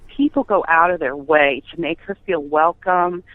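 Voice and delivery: usual speaking voice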